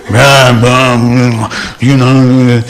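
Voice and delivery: a loud, dismissive blah blah; mock complaining. Speaker retells complaining to his friend, in a loud mock grumble. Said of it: "complain to him".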